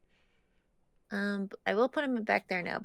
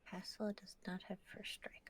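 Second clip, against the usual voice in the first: unvoiced whisper to self; 15 dB quieter than usual. Real, not acted. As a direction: whisper it under your breath. Reading card quietly to self.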